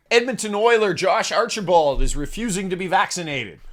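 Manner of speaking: usual speaking voice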